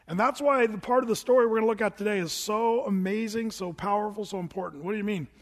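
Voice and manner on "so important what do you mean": usual speaking voice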